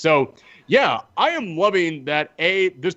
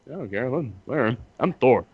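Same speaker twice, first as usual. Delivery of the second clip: put-on lazy drunk Thor; 3 semitones lower, faster than usual. Voicing an indifferent drunk Thor.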